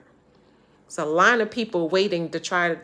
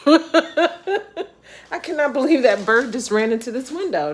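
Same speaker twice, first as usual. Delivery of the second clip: laughing; 8 semitones higher, 9 dB louder, slower than usual. Real, not acted. Laughing at bird hitting window.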